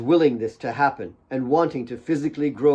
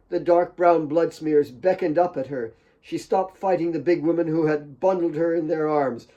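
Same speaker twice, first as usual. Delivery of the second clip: plain reading voice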